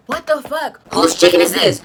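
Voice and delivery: loud, indignant retold cry; angry outburst. Finding a stranger's chicken, a loud indignant outburst. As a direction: demand an answer, furious. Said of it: "So I was so mad".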